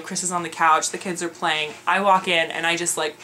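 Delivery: ordinary talk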